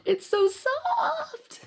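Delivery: put-on very high, laughing little-kid whine; laughing child whine. Getting off the preschool bus, giggling high toddler whine. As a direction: squeal it through giggles like a clingy toddler. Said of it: "Because I'm like".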